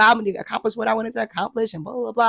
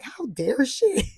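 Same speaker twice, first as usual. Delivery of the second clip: slow, drawn-out indignation; mock offended; 6 semitones higher, much slower than usual. Imitating her offended self after the therapist's doubt. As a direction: act scandalized. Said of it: "I was so offended".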